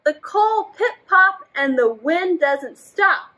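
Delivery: slow, expressive storybook reading; reading to children. A narrator's reading. Teacher reading a story to children. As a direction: draw the kids into the story. Reads picture book aloud.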